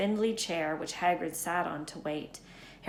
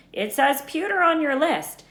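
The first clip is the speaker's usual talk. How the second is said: Hagrid voice; 9 semitones higher, 8 dB louder than usual. Acted. Hagrid voiced, high loud.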